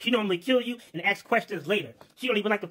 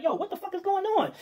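much higher, sudden alarm; startled shout; 11 semitones higher than usual. Acted. Worker seeing the commotion in the fields, higher startled outburst. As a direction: react like you just saw chaos. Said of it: "He like".

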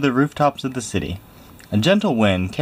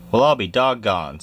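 usual speaking voice

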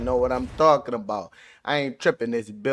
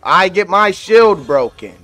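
ordinary delivery

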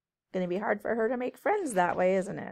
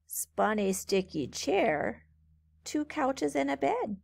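lilting storybook read-aloud; reading to children; slower than usual. A narrator's reading. Teacher reading a rhyming book to kids, lilting. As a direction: read it brightly to a group of kids.

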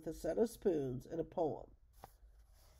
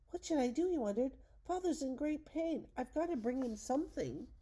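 put-on worried boy; 7 semitones higher than usual. Young Radius wonders aloud.

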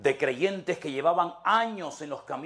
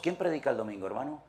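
ordinary delivery

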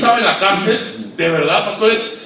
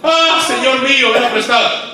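crying out; 6 semitones higher, 3 dB louder than usual. A narrator's reading. Woodcutter crying out to the prophet, loud and high. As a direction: cry out over the lost axe. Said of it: "Y gritó diciendo".

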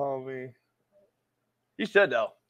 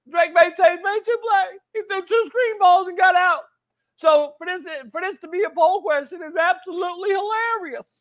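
put-on coach voice. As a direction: play a fired-up old-school coach scolding his quarterback, son. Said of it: "Do the rest of the show in that voice".

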